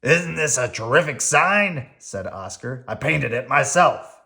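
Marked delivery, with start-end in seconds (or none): 0.0-1.9 gruff grouchy Oscar; grumpy garbage-can grouch. Acted. Oscar voiced. 2.9-4.2 gruff grouchy Oscar; grumpy garbage-can grouch. Acted.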